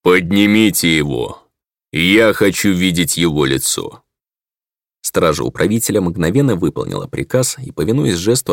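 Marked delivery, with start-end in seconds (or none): 0.0-4.0 slow commanding alien lord. A narrator's reading. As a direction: order it from the throne. Alien ruler commands guards. (громоподобный властный голос)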